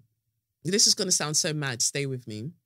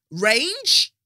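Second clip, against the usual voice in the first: Range called out higher and louder; 6 semitones higher, 5 dB louder than usual. Shown as a demonstration. Showing off the vocal range to viewers, pitched high. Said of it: "Look at the difference".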